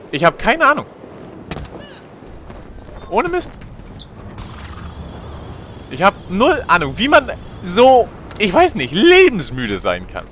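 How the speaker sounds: high disbelieving voice; astonished. Real, not acted. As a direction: marvel at the madness. Astonished at height.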